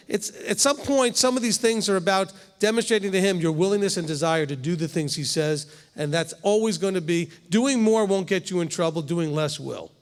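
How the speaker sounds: usual speaking voice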